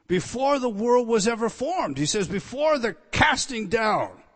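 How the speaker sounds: loud, driving pulpit delivery, pressing each point home; preaching at full force. Acted. Preacher to his congregation about redemption, preaching at full force. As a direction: thunder it out to the congregation.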